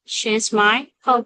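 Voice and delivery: usual speaking voice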